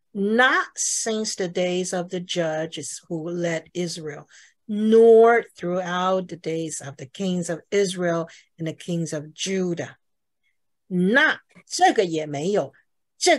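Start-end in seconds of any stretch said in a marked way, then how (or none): 0.1-10.0 slow, deliberate reading with stress on "nor"; 重读朗读. Shown as a demonstration. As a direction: read it out slowly, leaning on "nor" and "throughout". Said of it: "我会这样读". (慢速朗读，重读"nor")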